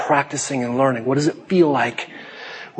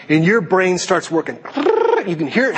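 ordinary talk, nothing marked

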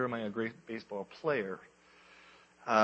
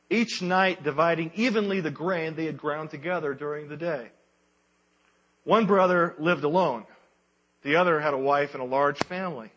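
solemn storyteller, voice lifted; grave ancient myth telling; 4 semitones higher, 6 dB louder than usual. A narrator's reading. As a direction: tell it like an old legend.

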